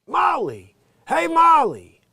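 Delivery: high, loud booming sitcom-husband call; Billy Gardell's big voice. Retold or imitated. Imitating the comic's big sitcom voice to the audience. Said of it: "I'm just waiting for the".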